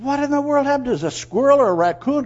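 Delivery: high, loud bewilderment; astonished. Acted. Man puzzles over his dug-up flower bed, astonished. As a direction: cry out in baffled alarm. Acts out shock at holes.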